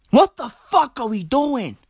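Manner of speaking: normal talking voice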